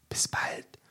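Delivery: soft whispered farewell. A narrator's reading. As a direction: whisper it, no voice at all. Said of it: "flüsterte er". (geflüstert)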